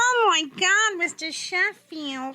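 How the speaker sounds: high, squeaky, drawn-out sitcom wail; Fran Drescher. Acted. Co-host breaking into the comedian's story, squeaky impression. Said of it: "that fucking squeaky ass voice".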